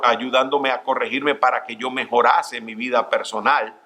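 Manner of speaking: ordinary talk, nothing marked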